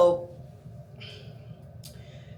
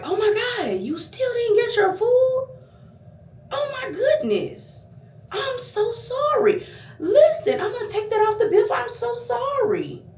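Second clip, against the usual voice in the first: high, sugary fake-apologetic waiter voice; mock the waiter's syrupy apology, way up high; 14 semitones higher than usual. Vlogger mocking the waiter's fake sorry, high and sugary. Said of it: "He just was like".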